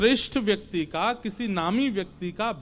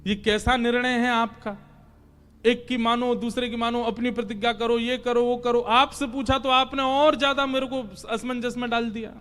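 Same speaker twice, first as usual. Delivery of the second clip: higher, rattling off a protest; exasperated complaint; 4 semitones higher than usual. Acted. Confused Arjun complaining to God, exasperated. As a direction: pile up the contradictory orders in mild exasperation.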